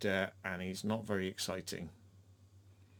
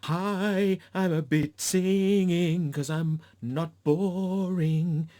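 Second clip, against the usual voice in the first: high, loud, slow showy parody; mocking a showy singer; 6 semitones higher, 5 dB louder, much slower than usual. Reviewer parodying the rest of the cast, loud high mockery. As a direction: ham up the other cast preening. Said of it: "everyone else had a little bit like".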